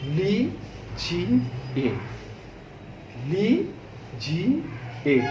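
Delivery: ली जी ए spelled out softly, syllable by syllable; slow, separate ली जी ए; syllable demo. Shown as a demonstration. Teacher spelling out ली जी ए for the class. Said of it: "ल जब हम बोल रहे हैं".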